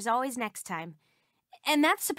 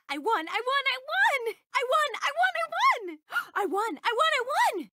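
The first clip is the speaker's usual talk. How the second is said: high elated cheer; excited cheer; 10 semitones higher, faster than usual. Acted. Game character cheering after winning, high and elated. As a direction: crow with delight.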